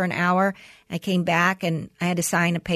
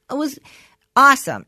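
a loud, higher burst of enthusiasm; excited; 7 semitones higher, 7 dB louder than usual. Retold or imitated. Son telling mom about his first therapy, bursting with excitement. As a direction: blurt it out thrilled. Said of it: "and he goes".

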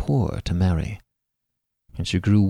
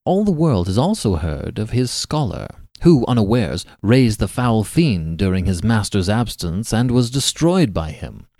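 plain reading voice

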